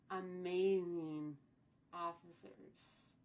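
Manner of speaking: very slow, stretched amazing; slow admiring emphasis; drawn-out praise. Real, not acted. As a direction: savour each syllable slowly. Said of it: "drawn-out praise".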